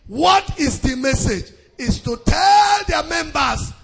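high, driving pulpit denunciation; impassioned preaching. Acted. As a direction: call out the big preachers forcefully. Preaches at full force.

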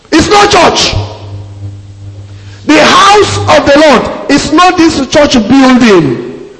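high, slow, forceful correction; preaching shout. Real, not acted. Preacher insisting to his church that it is not the building. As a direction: drive the point home from the pulpit. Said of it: "preacher correcting the congregation".

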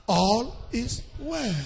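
slow, softened, drawn-out words; mock reassurance. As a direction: imitate a preacher lulling the members. Mimics preachers soothing their members.

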